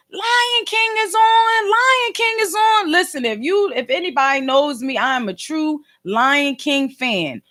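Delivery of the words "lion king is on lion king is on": high, slow, drawn-out holler; mock call to kids far down the street; imitating mom yelling. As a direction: stretch it out high like a calling mom. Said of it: "my mom gets to yelling".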